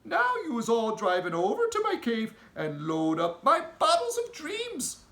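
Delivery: a big gentle giant with odd grammar; friendly giant. Acted. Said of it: "the B F G said".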